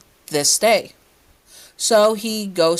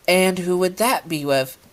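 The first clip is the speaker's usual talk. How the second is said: usual speaking voice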